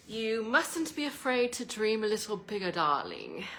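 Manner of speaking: a posh British put-on, teasing the listener; British accent. Mock British accent, coaxing viewers to dream bigger. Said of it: "How's my British accent?".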